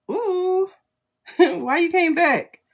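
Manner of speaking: a surprised laugh as the card returns; laughing surprise. Real, not acted. Card jumps out again.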